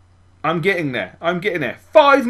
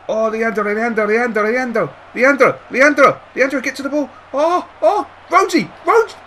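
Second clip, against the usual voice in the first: rising, louder calls to his players; will them toward the ball, climbing higher; excited urging; 6 semitones higher, 4 dB louder than usual. Real, not acted. Manager yelling at his striker to reach the ball, excited.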